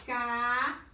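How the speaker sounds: each syllable alone, with long pauses between. Shown as a demonstration. Teacher building a word in the space below, syllable by syllable. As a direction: call out "K", "der", "nó" separately as you go. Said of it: "Vou pegar a sílaba K".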